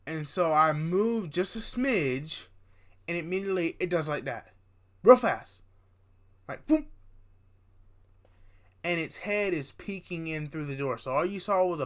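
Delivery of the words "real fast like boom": sharp jump in pitch and volume on boom; sudden loud burst. Retold or imitated. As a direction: punch it out like a jump scare. Describing the figure's sudden move.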